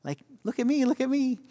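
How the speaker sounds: high, clingy plea for attention; a playful hint of a pestering husband; needy pestering. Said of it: "I wanna get up in her grill".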